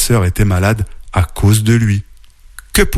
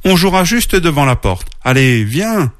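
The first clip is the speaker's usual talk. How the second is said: higher-pitched, persuasive little bear; insistent coaxing; 7 semitones higher than usual. A narrator's reading. Little bear urging his sister to play outside, insistent. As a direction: keep coaxing, lightly insistent. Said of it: "insista petit ours".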